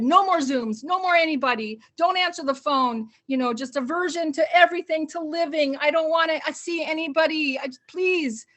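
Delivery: frazzled sitter giving up on everything, loud; fed-up outburst. Retold or imitated. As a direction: shut the whole world out, exasperated and loud. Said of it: "And so I said".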